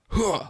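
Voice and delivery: sharp grunt mid-spin; tennis grunt. Retold or imitated. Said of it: "tennis grunt sounds".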